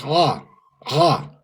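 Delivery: "Ha" breathed from the back of the throat, slow; guttural Ha. Shown as a demonstration. Teacher demonstrating the letter Hayin's sound, guttural. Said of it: "from back here".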